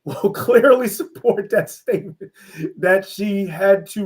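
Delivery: amused, laughing as you say it. Real, not acted. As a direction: laugh your way through the words. Joking aside about his wife.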